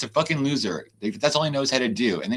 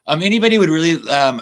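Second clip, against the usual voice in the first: loud outburst; 5 semitones higher, 9 dB louder than usual. Real, not acted. Bursts out upset.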